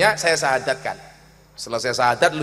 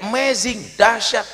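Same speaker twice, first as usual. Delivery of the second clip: higher-pitched mock cry of wonder; retold exclamation; 8 semitones higher, 3 dB louder, slower than usual. Foreign guest amazed by the dish, imitated exclamation. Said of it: "Eh, dia teriak".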